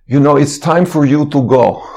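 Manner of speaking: put-on Russian-accented polite warning. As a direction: give notice in a Russian accent. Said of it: "speech in his Russian accent".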